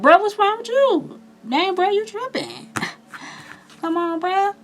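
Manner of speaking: high-pitched, loud homeboy calling out a friend; concerned homeboy. Acted. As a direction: put on a high, incredulous buddy voice. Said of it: "your homeboy's like".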